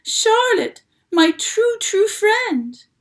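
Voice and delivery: high, loud sobbing wail; moaning with a sob. A narrator's reading. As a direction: cry it out, voice breaking. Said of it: "he moaned".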